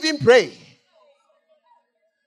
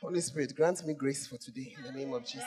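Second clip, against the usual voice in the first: soft, murmured plea for grace; quiet prayer; 4 semitones lower, 4 dB quieter than usual. Real, not acted. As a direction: pray under your breath for courage.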